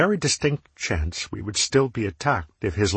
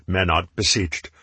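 ordinary delivery

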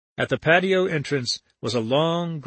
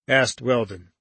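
plain reading voice

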